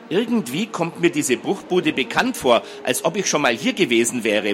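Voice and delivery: ordinary delivery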